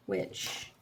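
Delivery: ordinary delivery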